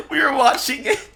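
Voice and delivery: high voice through laughter; helpless giggly retelling; laughing. Real, not acted. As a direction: try to tell it while cracking up. Laughing at the remembered scene.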